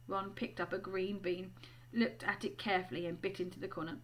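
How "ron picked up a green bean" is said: ordinary delivery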